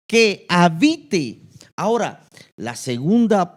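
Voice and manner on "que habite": slow emphatic phrase. Shown as a demonstration. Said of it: "el mensaje central es".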